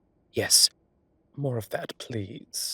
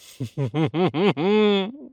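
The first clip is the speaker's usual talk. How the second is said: laugh; 8 semitones higher, 8 dB louder than usual. Acted. Laughs at the bickering.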